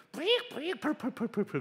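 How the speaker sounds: high-pitched whining starter squeal dropping into a sputter; imitated failing engine. Preacher telling how his car wouldn't start, mimicking the engine.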